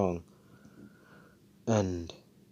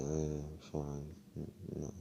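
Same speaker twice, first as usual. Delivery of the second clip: laid back; 4 semitones lower, 14 dB quieter, much slower than usual. Retold or imitated. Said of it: "he has that laid back attitude".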